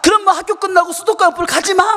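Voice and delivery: put-on high, loud child's protest; acted-out outburst of mock innocence; indignant insisting. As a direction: argue back like a wronged kid. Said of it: "막 그러면서 막 주장하대요".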